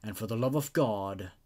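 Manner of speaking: measured, grave storytelling read; creepy story narration. Acted. Narrator reading a creepypasta to viewers, measured and grave. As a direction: draw the listener into an eerie tale.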